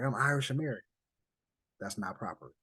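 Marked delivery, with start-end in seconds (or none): 0.0-0.8 quoting an immigrant, a bit higher and louder; mock proud claim of being Irish American; imitating someone claiming a label. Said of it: "and you be like".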